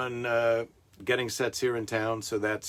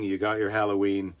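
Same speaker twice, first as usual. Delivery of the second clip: ordinary delivery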